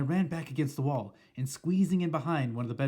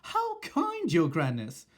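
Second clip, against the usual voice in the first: much higher, fawning squeak; silly burbling; 5 semitones higher, 5 dB louder, slower than usual. Retold or imitated. Witches fawning over their leader, high and silly. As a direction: gush like a flattering witch. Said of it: "burbling their silly sentences".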